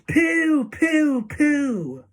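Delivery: high, loud repeated hoots, stepping down; audience cry. A narrator's reading. Audience jeering at children's smell, high hooting. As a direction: shout each hoot as a separate falling cry. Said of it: "cried the audience".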